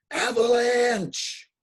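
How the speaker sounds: long-drawn, high, very loud warning cry; alarmed shout. Acted. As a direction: yell the warning across a valley. Chipmunk cries a warning of avalanche.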